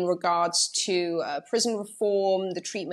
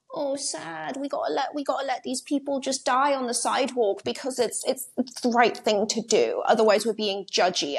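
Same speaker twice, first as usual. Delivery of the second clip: higher, mock-pitying voice; mimicking precious do-gooders, pitched up; mocking imitation; 5 semitones higher than usual. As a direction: put on fake, hand-wringing sympathy. Mocks influential people's hand-wringing.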